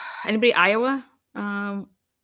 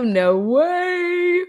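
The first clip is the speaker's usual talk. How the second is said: surprised; 10 semitones higher, 4 dB louder than usual. Real, not acted. Viewer location in live chat.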